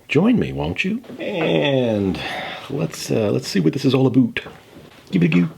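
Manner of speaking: high, sing-song host invite; mock-grand invitation. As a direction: lure the viewers along with a jaunty lilt. Host's mock-grand invitation to journey.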